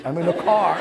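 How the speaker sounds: laughing through the repeated answer; half-laughing, fed up at repeating it; exasperated laugh. Retold or imitated. To the caller who asked again, laughing in exasperation.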